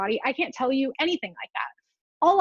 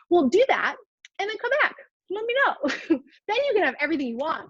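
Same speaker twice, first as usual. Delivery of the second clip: sarcastic mock advice; 5 semitones higher than usual. Sarcastic mock of weight-centric advice.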